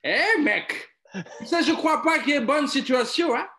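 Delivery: Eddie Murphy impression. Friend does the quote as Axel Foley, a brash comic cop voice. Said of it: "C'est Eddie Murphy, ça".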